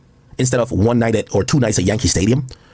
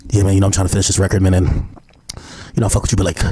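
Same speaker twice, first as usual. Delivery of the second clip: imitating [name]; 6 semitones lower, much faster than usual. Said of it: "why you sound like this".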